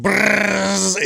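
high rolled buzzing noise; imitated cicada buzz. Man recreating cicada noise outside the car, mock buzz. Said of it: "it's like".

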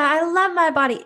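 high, bright hollow yes; pretending body love with eyes closed, high and hollow; mock chirpy claim. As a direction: fake it like you don't mean it.

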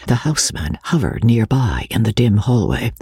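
plain reading voice

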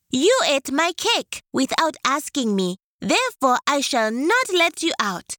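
high, mildly stern tortoise; high tortoise with a touch of reproach; tortoise scolding. Acted. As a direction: scold him in a high small voice.

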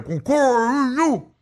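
mock singing through a mouthful; high stuttered singing with full mouth. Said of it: "no me manda a cantar con la boca llena".

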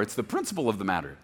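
usual speaking voice

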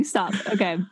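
normal talking voice

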